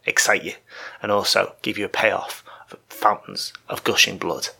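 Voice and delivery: ordinary talk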